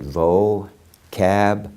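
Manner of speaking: "vocab" slowly split into two separate syllables; phonetic pronunciation. Shown as a demonstration. Teacher showing students phonetic pronunciation, "vocab" broken into slow syllables. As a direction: sound out "vocab" syllable by syllable, with a gap. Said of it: "this is called phonetic pronunciation".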